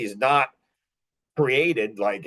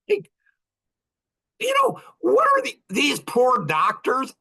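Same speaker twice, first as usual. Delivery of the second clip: mock pity cry; 11 semitones higher than usual. Real, not acted. Pitying doctors on his vlog, much higher mock cry.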